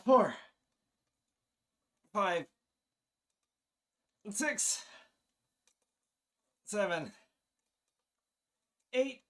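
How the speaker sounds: strained counting, breaking into laughs; grunting out each rep; straining counting. Real, not acted. Straining through push-up reps, laughing.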